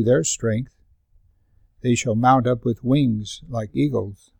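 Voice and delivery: slow, measured reading of the verse; slow scripture reading. Acted. Pastor reading Isaiah to the congregation, slow and measured. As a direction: read it slowly, savouring the promise.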